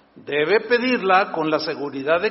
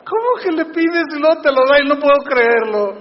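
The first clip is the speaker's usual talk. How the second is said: burla aguda y fuerte; sarcasmo; 8 semitones higher, 9 dB louder than usual. Real, not acted. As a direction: incredulidad burlona, alta y sonora. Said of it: "estoy siendo muy sarcástico". (el pastor se burla de la duda, agudo y fuerte)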